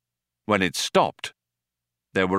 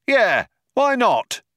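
high, slow, drawn-out reply; calling back agreement; 4 dB louder, slower than usual. Acted. Other cop agrees to shoot again, calling back. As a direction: holler assent to a partner.

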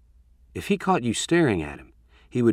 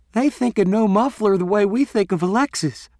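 giggling; 11 semitones higher, 5 dB louder than usual. A narrator's reading. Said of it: "Leandra giggled".

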